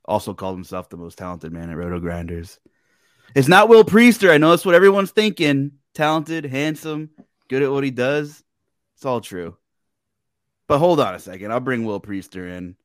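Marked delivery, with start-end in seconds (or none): 3.3-8.4 hyped-up. Acted. Mock hype about the guest.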